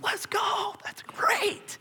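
hyped friend cheering your news; excited cheer. Retold or imitated. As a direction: cheer it out like a fan. Celebrator voiced.

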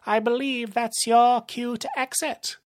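high villain voice, slowing for effect; theatrical villain threat; gloating villain. Acted. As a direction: deliver the threat with relish. Narrator voices Rumble.

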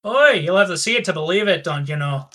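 light, quick Scottish teasing; a playful Scottish tease; Scottish accent. Acted. GM as Manda teasing the party in a light Scottish accent. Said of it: "to escape the accents".

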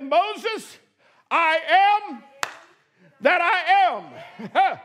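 slow, drawn-out divine pronouncement; voice of God. Acted. Preacher voicing God answering Moses, slow and grand. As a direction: weigh each word as God speaking.

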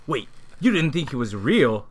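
usual speaking voice

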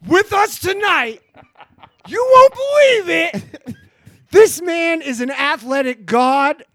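hype announcer intro. Acted. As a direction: hype him up like a prizefighter. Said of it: "can we get an introduction like that".

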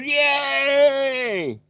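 a football player cheering a touchdown, high whoop; cheering yay. Retold or imitated. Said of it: "raises his hands in the air and goes".

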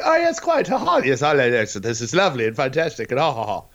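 prance about as a fancy gent, greeting everyone; posh toff. Retold or imitated. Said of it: "that's not how they talked".

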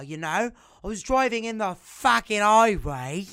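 loud, swaggering mixed British-Australian-Irish accent. Shown as a demonstration. Demonstrating a talent for swearing, loud British-Australian-Irish mix. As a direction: lean hard and loud on the swearwords in mongrel British-Aussie. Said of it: "anglais, British, euh, australien, euh, irlandais, un mélange de tout".

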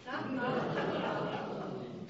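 breathy high laugh at the absurdity; a quick disbelieving laugh; short laugh. Real, not acted. Laughs after dry quote.

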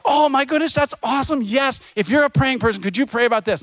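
high, gushing excitement; excited customer. Retold or imitated. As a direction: squeal with delight at the offer. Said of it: "people go like".

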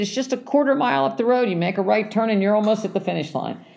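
high, fast chirpy directions; cheery stranger. Retold or imitated. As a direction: rattle off directions brightly like a helpful passerby. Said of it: "And she said".

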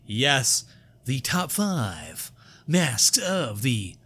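big show-host build with drawn-out title; hammed-up announcer. Host teasing the top five before the break, hammed-up announcer. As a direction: announce it grandly, stretching the final words.

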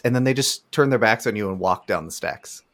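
usual speaking voice

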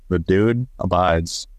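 slow, low laid-back Dude drawl; lazy stoner drawl, stretched out. Retold or imitated. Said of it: "Lebowski sweater".

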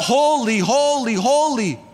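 a hint of a worshipful cry. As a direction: lift it up like creatures crying out. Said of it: "they're crying out".

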